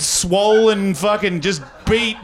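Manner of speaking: ordinary delivery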